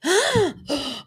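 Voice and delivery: high mock gasp; a shocked sharp intake of breath. Host voicing Kyle's shocked gasp at Dorit. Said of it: "Kyle's like".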